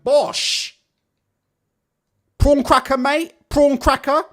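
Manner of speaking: high, loud mocking offer of prawn crackers; hammed-up Fisher pushing snacks on a beaten opponent; imitating Johnny Fisher. Podcaster mocks Fisher offering prawn crackers backstage, high and loud. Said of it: "they got Johnny Fisher in the change room going".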